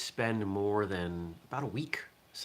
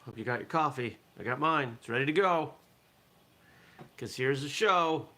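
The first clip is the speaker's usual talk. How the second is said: put-on Southern-New England accent; 4 semitones higher than usual. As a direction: greet the morning show in a homespun Southern-New England accent. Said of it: "with that accent".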